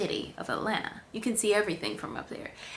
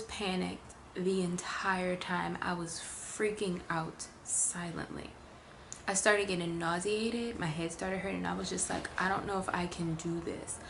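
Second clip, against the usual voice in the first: slow, soft, low confession; quiet shaken recollection; hushed; 3 semitones lower, 8 dB quieter, slower than usual. Real, not acted. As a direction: murmur the dread. Recalling silent panic.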